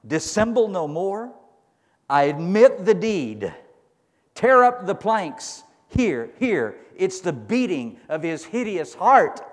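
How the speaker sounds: high, loud, slow cry of a guilty man breaking; shrieking confession. Acted. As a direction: shout the confession as if it bursts out of him. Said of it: "he shrieks and he says this".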